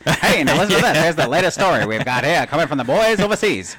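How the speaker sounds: put-on loud, high old-time radio announcer; 1940s newscaster. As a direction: announce it like a wartime newsreel. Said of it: "he sounds like a newscaster from the forties".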